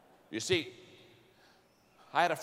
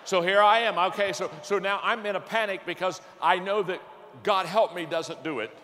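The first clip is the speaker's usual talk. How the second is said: louder, higher pulpit delivery; preacher pumping up the story of his panic; lively preaching; 5 semitones higher, 6 dB louder than usual. Acted. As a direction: drive it home with rising energy. Preacher stirring crowd with panic story.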